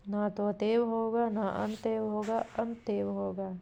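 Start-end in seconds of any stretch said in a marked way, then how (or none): none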